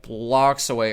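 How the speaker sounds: ordinary talk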